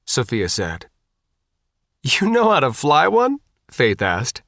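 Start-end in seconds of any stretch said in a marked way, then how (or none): 2.0-3.4 high, panicky question; somewhat hysterical. A narrator's reading. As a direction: ask it on the edge of panic, pitched high. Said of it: "somewhat hysterically".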